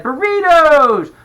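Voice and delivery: BURRITOS drawn out high and loud; triumphant cheer. A narrator's reading. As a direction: yell it in triumph, stretched long. Girl cheers her food choice.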